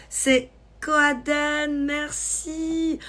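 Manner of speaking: high, drawn-out thank-you; effusive thanks. Real, not acted. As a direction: sing out the thanks, stretched and high. Thanking a new follower.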